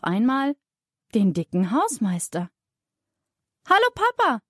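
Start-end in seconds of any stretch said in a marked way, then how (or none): 3.7-4.4 high-pitched call to dad. A narrator's reading. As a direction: call out high, as a child greeting a parent. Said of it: "ruft Thea". (hohes Rufen)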